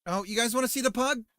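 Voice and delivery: perking up to show off the dog; excited. Real, not acted. Streamer excitedly offering to show the pug.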